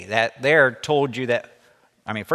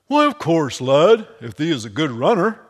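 lightly voiced hearty old miller; 6 semitones higher, 8 dB louder than usual. Miller answering Obadiah, lightly voiced hearty old man.

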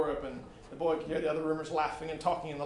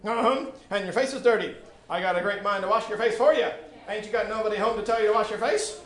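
louder, higher motherly scolding; stern scolding matriarch; scolding woman; 5 semitones higher, 7 dB louder than usual. Acted. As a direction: scold him like a stern aunt. Woman scolds dirty-faced boy.

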